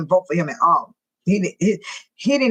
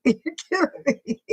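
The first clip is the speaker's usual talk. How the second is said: laughing; 6 semitones higher than usual. Real, not acted. Laughing at Nikki's comment.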